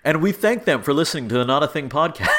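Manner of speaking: brisk, bright announcer sign-off; mock podcast sign-off. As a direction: wrap it up like a radio host. Mock sign-off to couch potatoes.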